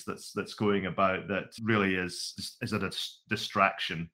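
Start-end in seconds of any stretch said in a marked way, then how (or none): none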